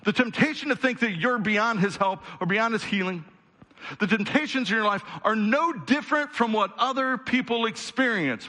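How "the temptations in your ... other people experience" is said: ordinary talk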